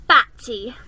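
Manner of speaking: loud scornful jab; mock her; a spiteful brother mocking his sister. A narrator's reading. Edmund mocks, tapping head.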